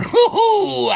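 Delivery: high, loud whooping hoo-hoo. Retold or imitated.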